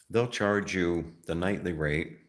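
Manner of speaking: ordinary delivery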